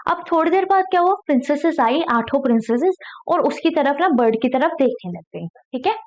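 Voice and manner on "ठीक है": ordinary delivery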